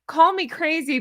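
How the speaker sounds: usual speaking voice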